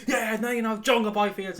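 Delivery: fan's inner monologue, mock gloating. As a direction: gloat inside your own head. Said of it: "my voice is a bit going".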